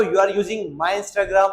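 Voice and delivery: every word slow and separate; slow, careful teacher read; slow model sentence. Shown as a demonstration. Teacher modelling the English sentence slowly. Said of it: "तुम मेरा Instagram चला रहे हो".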